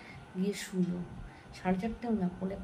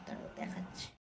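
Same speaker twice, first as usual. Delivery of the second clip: hushed whisper, softer than her talk; real whisper; 10 dB quieter than usual. Real, not acted. Mother whispering so her sleeping daughter won't wake, hushed whisper. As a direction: whisper it so nobody wakes up. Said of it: "খুব আস্তে আস্তে করে কথা বলছি".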